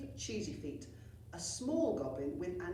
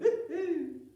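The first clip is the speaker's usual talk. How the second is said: high, loud cackling laugh; loud character cackle; hearty cackle; 11 semitones higher, 8 dB louder than usual. Acted. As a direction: let out a big witchy cackle. Carty laughs after speaking.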